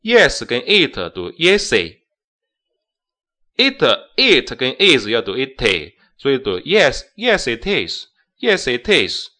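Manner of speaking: "yes it is" all three joined; linking yes it is. Shown as a demonstration. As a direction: run all three words together. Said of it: "这三个单词都要连读". (三个词连读)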